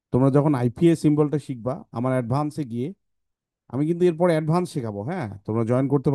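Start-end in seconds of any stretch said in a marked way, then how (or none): none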